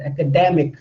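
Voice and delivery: "academic" with the stress on dem, falling at the end; stress on the third syllable. Shown as a demonstration. Teacher confirming the stressed syllable, "academic" stressed on dem. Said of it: "da here is stressed".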